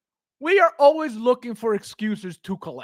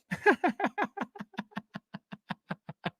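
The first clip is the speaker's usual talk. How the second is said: wheezy fading laugh; helpless laugh trailing off softly; 10 semitones higher, 8 dB quieter than usual. Real, not acted. Streamer cracks up at own joke, wheezy laughing. Joke about two Chrises.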